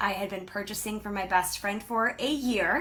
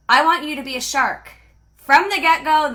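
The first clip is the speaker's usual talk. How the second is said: louder, higher, pumped-up push; rallying call; 4 semitones higher, 9 dB louder than usual. Real, not acted. Director rallying her sales team, pumped-up louder call. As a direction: fire the team up like a coach.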